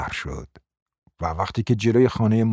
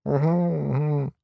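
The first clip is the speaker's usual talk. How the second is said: dull grunt; 4 semitones higher, slower than usual. A narrator's reading. Dim husband grunting at his wife, slow and dull. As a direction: grunt like a simpleton.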